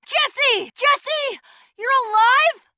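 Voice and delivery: high, loud joyful cries of a name; joyful calling. Acted. Overjoyed to find Jesse still alive. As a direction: call out in relieved joy.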